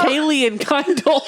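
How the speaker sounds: "Kaylee" said with an ay sound, Kendall plain; mocking the slip. To her cohost, mimicking the mispronounced Kylie. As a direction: teasingly repeat the name as "Kaylee and Kendall". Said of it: "What did you say?".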